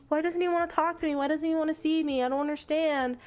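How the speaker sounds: put-on high, plaintive girl's voice; mock whine. Girl wondering why he avoids her, plaintive and high. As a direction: play a hurt, puzzled girlfriend. Girl upset at being ignored.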